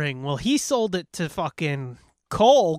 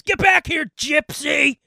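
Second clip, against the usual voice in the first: high, loud angry shout; screaming; 9 semitones higher, 4 dB louder than usual. Retold or imitated. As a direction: yell after the fleeing thief. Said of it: "He just screams".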